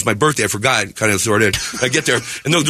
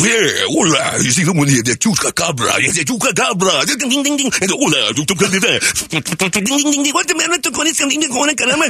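hammed-up Mexican radio host, swinging into high ding-dings; Mexican morning show; 4 semitones higher than usual. Comedian doing requested Mexican morning show impression, hammed-up hosting. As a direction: parody a manic Spanish-language morning show. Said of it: "your impression of a Mexican morning show".

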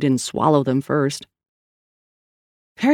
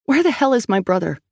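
raised-pitch confrontation; angry demand; 7 semitones higher than usual. A narrator's reading. Sal confronts the pair at gunpoint.

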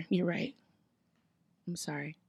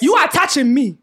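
put-on louder African-accent protest; 5 semitones higher, 8 dB louder than usual. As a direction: complain in the accent. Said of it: "different accent".

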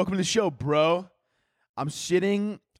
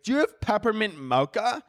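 a lightly higher mock city girl asking; 9 semitones higher than usual. Host mimicking a city customer at a diner, lightly higher.

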